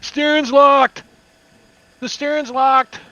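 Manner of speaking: high, loud cry of alarm; play-acted panicked driver's yell. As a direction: shout an urgent warning from the controls. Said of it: "and he's like".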